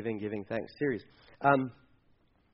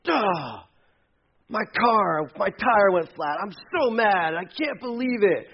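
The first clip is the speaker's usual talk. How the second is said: higher, petulant griping; mock whiny complaint; 5 semitones higher, slower than usual. Pastor mocking a first-world complaint, whiny. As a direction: whine like a spoiled driver. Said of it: "first world problems".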